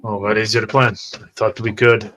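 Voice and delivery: normal talking voice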